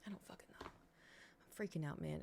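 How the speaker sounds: whispered, slowing right down on freaking out; panicked whisper. Real, not acted. Streamer panicking to chat over Padme's hair: desperate whisper.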